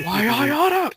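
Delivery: high, loud strangled threat; Homer Simpson. Retold or imitated. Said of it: "He's turning Homer Simpson on us".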